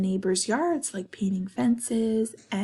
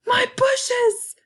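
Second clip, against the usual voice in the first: high, loud, acted exclamation; dismayed outcry; 6 dB louder than usual. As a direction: cry out as a shocked mom. Mom exclaims at the wrecked yard.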